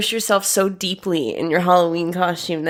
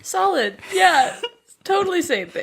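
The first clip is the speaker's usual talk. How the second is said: high laughing reply; 10 semitones higher than usual. Real, not acted. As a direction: laugh through the agreement.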